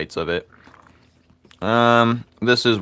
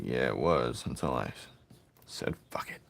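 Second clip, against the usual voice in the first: quiet; 7 semitones lower, 9 dB quieter than usual. Acted. Quiet sly aside.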